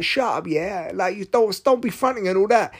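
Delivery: high mock street accent; Jafaican accent. Kid on the bus telling someone off, mock Jafaican accent. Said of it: "Jafaican kind of accent".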